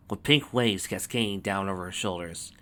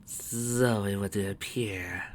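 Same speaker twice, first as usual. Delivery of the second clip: very slow, drawn-out villain drawl; languid, knowing reply; cool villainess; 5 dB quieter, much slower than usual. A narrator's reading. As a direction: stretch it out, softly unimpressed. Villain answers coolly.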